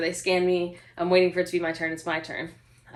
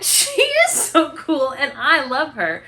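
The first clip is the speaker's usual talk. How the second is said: delighted gush; 5 semitones higher, 4 dB louder, slower than usual. Retold or imitated. Retelling her own delighted reply.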